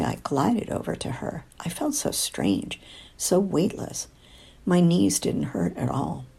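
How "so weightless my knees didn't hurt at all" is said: usual speaking voice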